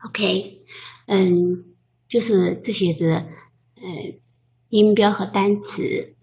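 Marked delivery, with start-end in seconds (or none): none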